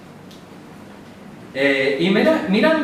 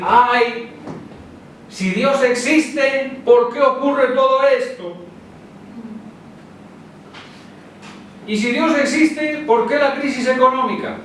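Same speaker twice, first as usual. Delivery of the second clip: higher, slow mock lament; doubting believer; 6 semitones higher than usual. Preacher imitating a doubter during crisis, higher mock lament. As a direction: whine like a sceptic asking why. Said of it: "Y empieza a decir".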